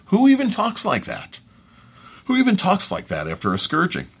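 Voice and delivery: baffled question that starts very high; acted disbelief, pitch leaping then settling; incredulous. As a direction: ask it as if it's absurd. Voicing Pilate's baffled reaction to Jesus.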